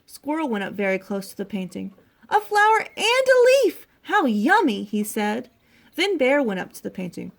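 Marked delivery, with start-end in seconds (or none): 2.3-4.8 high, loud burst of delight. A narrator's reading. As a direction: exclaim like a thrilled storybook squirrel. Squirrel delighted by close look.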